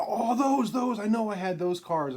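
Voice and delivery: high, loud burst of recognition; light up as you recognize them; excited recognition. Retold or imitated. Jim seeing the fifty-two Topps on the phone, loud excited recognition.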